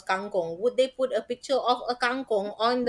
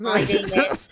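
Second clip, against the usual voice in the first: laughing guard voice; 4 dB louder than usual. Retold or imitated. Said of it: "in that tone of voice".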